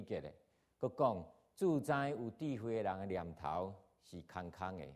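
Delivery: steady, measured Bible reading to the congregation; scripture reading. Acted. Pastor reading First Corinthians to the church, steady and measured. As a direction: read the passage out evenly, verse by verse.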